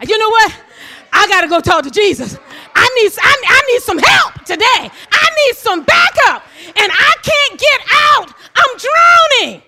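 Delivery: loud urgent plea for backup; desperate plea. Acted. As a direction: beg for rescue like you're drowning. Desperate disciple pleading.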